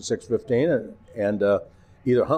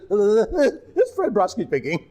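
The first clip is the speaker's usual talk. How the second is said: laughing sign-off; 10 semitones higher, slower than usual. Retold or imitated. Rushed sign-off while laughing.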